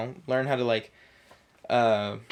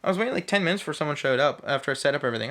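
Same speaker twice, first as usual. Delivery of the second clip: ordinary talk, nothing marked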